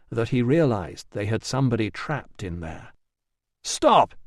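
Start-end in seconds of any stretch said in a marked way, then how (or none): 3.6-4.1 sudden high shout; yell. A narrator's reading. Rousseau yelling at the women to stop. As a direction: bark it out to stop them. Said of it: "he yelled".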